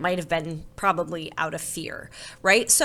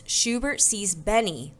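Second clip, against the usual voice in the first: plain reading voice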